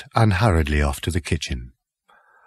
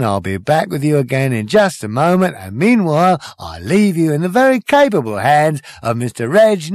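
high, bright-eyed compere patter, louder; showbiz host; 8 semitones higher, 4 dB louder than usual. Acted. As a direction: hype the crowd like a cabaret host. Compere introducing the band.

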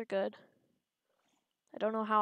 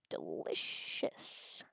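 slow, hushed, drawn-out relish; soft gloating over a tasty bite; savouring whisper; 11 dB quieter, much slower than usual. Pretend. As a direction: whisper it slowly, lingering on the hiss. Savouring an eaten cell.

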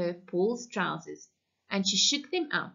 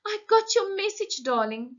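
motherly woman voice; 11 semitones higher than usual. A narrator's reading. Motherly woman greets husband.

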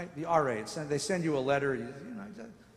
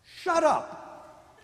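God's rebuke; 8 semitones higher, 6 dB louder than usual. Retold or imitated. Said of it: "God says".

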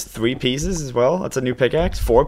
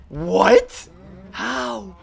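surprised; 8 semitones higher than usual. Real, not acted. Startled by something odd.

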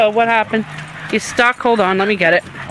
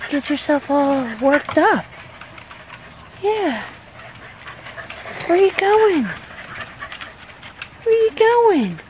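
high, sing-song coaxing to the dog; pet talk; 3 semitones higher, 5 dB quieter than usual. Real, not acted. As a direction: coo at the worried puppy, high up. Talking to the upset dog.

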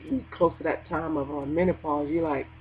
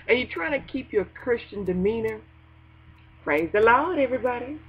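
ordinary delivery

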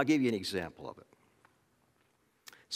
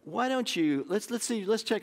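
higher, gentle prompting of a facilitator; 9 semitones higher than usual. Retold or imitated. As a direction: coax him like a patient counselor. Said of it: "this person who was facilitating said".

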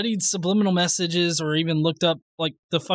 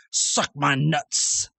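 slow, low, deadpan mock of the guy; sarcastic parody of his insult; mocking the flat earther; 7 semitones lower, much slower than usual. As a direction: drag it out like a dumb catchphrase. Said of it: "ninety percent of this video is".

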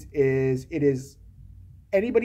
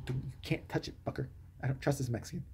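whispered, hissing protectiveness; mock whisper; 4 semitones lower, 15 dB quieter than usual. Mocking the heroine guarding her baby in a whisper. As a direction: hiss it under your breath.

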